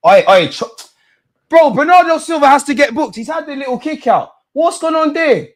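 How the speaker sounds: high, loud protest at the ref; angry fan yelling about a foul; outraged yell. Real, not acted. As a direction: shout it at the referee. Yelling at referee decision.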